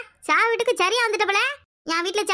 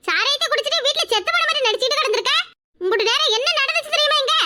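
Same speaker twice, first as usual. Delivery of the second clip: scolding; 6 semitones higher than usual. Acted. Scolding drunk mother-in-law.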